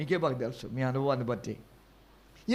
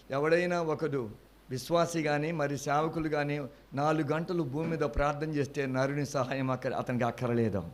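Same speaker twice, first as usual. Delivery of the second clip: ordinary talk, nothing marked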